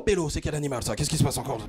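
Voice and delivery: ordinary delivery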